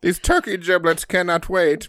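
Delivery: high dramatic declaration; hammed-up old-timey voice; mock pompous aristocrat. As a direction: announce it with mock urgency. Period-drama parody continues.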